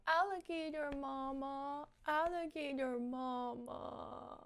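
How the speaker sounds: soft, slow, whimpery imitation of a small child; imitating crying child. Mother retells her son's nightmare, softly imitating his cry. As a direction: echo his sleepy little cry, quietly. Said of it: "start crying and going".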